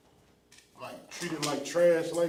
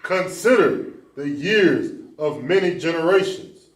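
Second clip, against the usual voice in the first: loud repeat; 3 semitones higher, 11 dB louder than usual. Real, not acted. Member repeating the verse loudly after the host asks, loud and clear. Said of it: "Consider what?".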